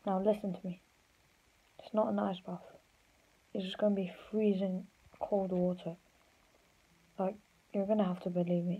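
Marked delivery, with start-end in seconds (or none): none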